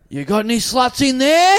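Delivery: high, loud shouted question; mock shout. Crazy man calling up to the balcony, mock shout. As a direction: call it up the street. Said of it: "And he goes".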